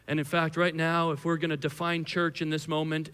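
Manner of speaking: usual speaking voice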